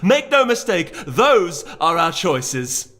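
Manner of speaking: high, loud impassioned ultimatum; Celerity declaiming to the council, high and loud; dramatic declaration. A narrator's reading. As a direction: point and proclaim to the room. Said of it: "pointed dramatically".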